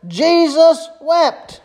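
very slow, drawn-out "Jesus wept" with weight on "Jesus"; slow emphatic. Acted. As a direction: stretch each word of "Jesus wept" like a pronouncement. Preacher dwells on the verse.

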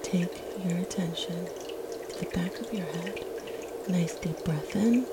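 ordinary talk

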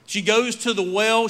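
usual speaking voice

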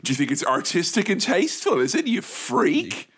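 high-pitched indignant comic rant; mock outrage. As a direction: rant in exaggerated disbelief at the culprits. Mock rant at photo sharers.

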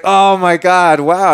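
high, loud retold amazement; wowed, re-enacted; astonished. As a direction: react as if floored by the reveal.

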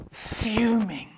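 breathy whisper on fuming. Acted. Preacher to congregation, hushed whisper. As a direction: drop to a hush for fuming.

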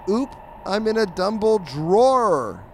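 high, loud, drawn-out punchline; hammed-up cartoon cat. Cat under a sheet makes a pun, hammed-up. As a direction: belt out the bad pun slowly.

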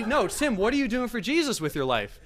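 high, insistent mock challenge; mock exhortation. Dad pressing guest about his life, insistent mock exhortation.

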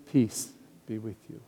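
calm them with it; gentle greeting. Acted. Jesus greeting the frightened apostles, soft and tender.

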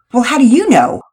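sharp, loud retort; shooting back. A narrator's reading. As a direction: fire the question back at her. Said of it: "Nora shot back".

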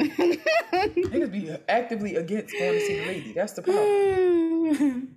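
high, laughing through the words; cracking up mid-sentence. Real, not acted. Laughing at her own joke.

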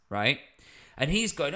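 ordinary delivery

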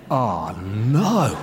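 low, softened groan of realisation; mock dismay. Customer realises the simple fix, mock dismay. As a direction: let the embarrassment sink in, hammed-up.

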